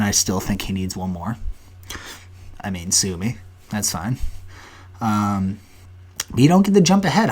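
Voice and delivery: usual speaking voice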